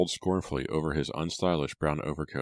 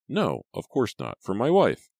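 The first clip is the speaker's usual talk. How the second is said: rising, louder flustered protest; embarrassed man; 7 semitones higher, 5 dB louder than usual. A narrator's reading. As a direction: blurt it out, embarrassed. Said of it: "The man blushed".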